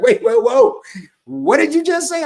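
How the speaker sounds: high, loud, startled "whoa"; baffled interruption. Acted. Baffled co-host stopping him to ask, high startled voice. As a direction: cut in high and loud, taken aback.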